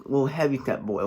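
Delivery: normal talking voice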